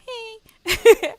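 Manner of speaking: bright, loud call of hey; breezy hello tossed out loud; a big friendly hey; cheery greeting. Retold or imitated. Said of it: "walking out and just like".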